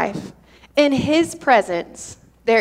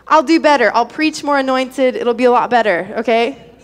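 normal talking voice

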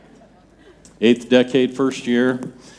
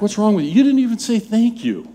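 high, exasperated scolding, half-mock; exasperated wife; 9 semitones higher, faster than usual. Wife scolds husband for not thanking, high exasperated. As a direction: mimic the wife's pitched-up scolding. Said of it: "It's like".

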